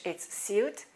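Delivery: suit said with a light Y, syoot; British suit. Shown as a demonstration. Teacher showing British suit, syoot. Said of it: "In British English".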